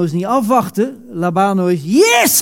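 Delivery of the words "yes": a sudden high shout of joy; excited yes. Acted. Preacher acting out a believer grabbing grace, shouting yes. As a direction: burst out with a triumphant yes.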